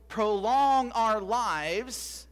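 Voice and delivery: prolong drawn out very slowly, rising; air-quoted emphasis. Shown as a demonstration. Preacher to congregation, air-quoting prolong with a slow drawn-out stress. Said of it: "quote unquote".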